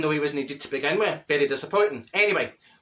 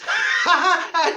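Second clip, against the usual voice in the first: laughing; 11 semitones higher, 5 dB louder than usual. Real, not acted. Laughs about the photos.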